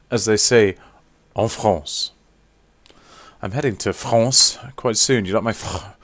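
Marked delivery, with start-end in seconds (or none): 1.3-2.1 put-on French pronunciation; playful French flourish; French accent. Shown as a demonstration. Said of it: "French accent".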